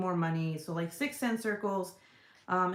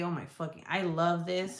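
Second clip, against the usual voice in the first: normal talking voice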